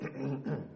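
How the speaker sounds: soft mock throat clearing; imitated throat clear. Pastor imitating a doctor's soft throat clear. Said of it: "there's a lot of times he'll go".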